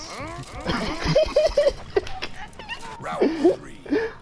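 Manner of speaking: long, very high-pitched cackling laugh; high laughing fit. Real, not acted. Player cracking up mid-match, high laughing fit.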